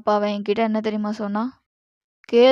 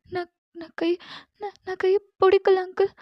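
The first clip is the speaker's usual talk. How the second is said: put-on frightened girl pleading; 9 semitones higher than usual. Captive girl begs Jimman to send her home, frightened pleading. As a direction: beg your captor like a scared young girl.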